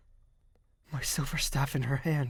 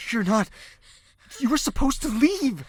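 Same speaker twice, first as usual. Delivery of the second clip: distressed raised voice; 10 semitones higher, 7 dB louder than usual. Acted. Upset at seeing her there.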